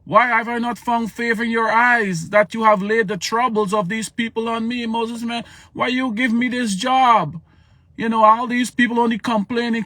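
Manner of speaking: high, loud exasperated prophet; Moses complaining. Acted. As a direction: vent at God like an overwhelmed leader. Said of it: "Moses like".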